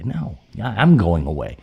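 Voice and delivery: normal talking voice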